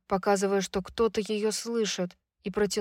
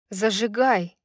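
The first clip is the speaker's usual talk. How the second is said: usual speaking voice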